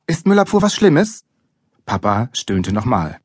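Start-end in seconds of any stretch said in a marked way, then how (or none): 0.1-1.2 high, innocent questioning lilt; naive child's question. A narrator's reading. Boy to lecturing father, naive. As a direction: ask it in all innocence.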